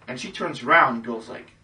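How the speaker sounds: ordinary delivery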